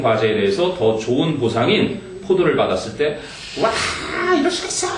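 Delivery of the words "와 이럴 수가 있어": outraged monkey. Retold or imitated. Angry monkey voiced in story.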